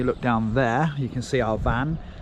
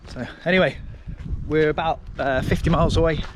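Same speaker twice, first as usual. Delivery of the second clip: wind on mic; 3 semitones higher than usual. Real, not acted. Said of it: "Excuse the wind noise".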